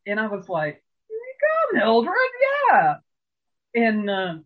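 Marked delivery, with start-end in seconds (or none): 1.1-3.0 high, loud whooping cheer; cheering the waitress on, high and loud; cheering her on. Retold or imitated. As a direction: cheer for Mildred. Cheering Mildred on.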